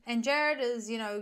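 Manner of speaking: ordinary delivery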